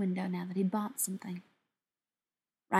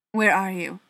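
firm, louder demand; 7 dB louder than usual. A narrator's reading. Beckett on the phone to Ryan, demanding. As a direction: demand an answer, firmly and loudly. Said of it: "Beckett demanded".

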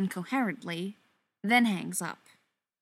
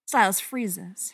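ordinary delivery, nothing marked

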